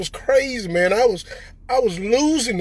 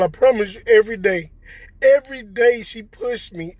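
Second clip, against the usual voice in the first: ordinary delivery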